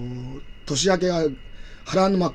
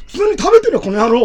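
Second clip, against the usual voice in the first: loud, rough Takeshi-style retort, pitched much higher; Takeshi impression; 11 semitones higher, 8 dB louder than usual. Father-in-law snapping at his son-in-law in a loud Takeshi voice. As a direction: bark it back like Beat Takeshi. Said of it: "たけしになっちゃってね".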